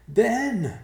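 high, loud cry of a name; piercing high call. A narrator's reading. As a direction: call urgently across a distance. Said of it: "she called".

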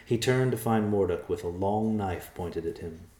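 usual speaking voice

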